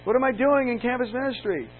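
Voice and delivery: high, loud alarmed recoil; mock horrified young minister; startled protest. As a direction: push the letter away in alarm. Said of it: "I just went".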